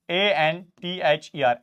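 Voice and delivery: letters A N T H E R spelt slowly one by one; spelling out. Shown as a demonstration. (A N T H E R धीरे-धीरे अक्षर बोलना)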